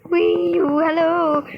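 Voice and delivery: high, loud sing-out easing back down; mock gleeful entrance. Creepy customer arriving at the hair salon, mock gleeful high greeting. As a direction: imitate an over-eager customer calling hello. Acting out creepy character walking in.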